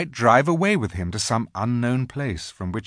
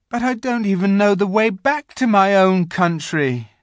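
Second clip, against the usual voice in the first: put-on high, loud plea of a boy; pleading; 11 semitones higher, 7 dB louder than usual. A narrator's reading. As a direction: plead anxiously, pitched well up. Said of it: "pleaded Edmund".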